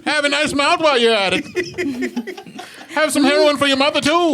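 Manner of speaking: put-on old-time radio ad. Mock vintage radio ad.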